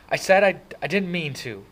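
high, loud, upset insistence; frustrated protest. A narrator's reading. Kid near tears protesting to brother, frustrated. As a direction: protest as frustration boils over.

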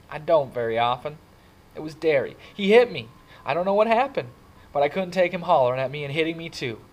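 high, agitated, loud venting; upset outpouring. A narrator's reading. Kid confiding that brother hit him, upset and agitated. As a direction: pour out the hurt to a friend.

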